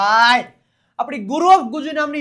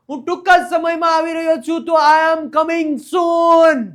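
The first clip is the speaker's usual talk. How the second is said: dramatic shout; 4 semitones higher, 8 dB louder, slower than usual. Shown as a demonstration. Teacher booms the sentence dramatically.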